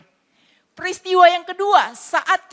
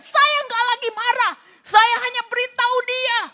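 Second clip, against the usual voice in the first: high, strained imitation of a shouting husband; booming angry denial; 9 semitones higher than usual. A husband yelling he is only telling his wife, mock angry high. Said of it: "teriak menggelegar".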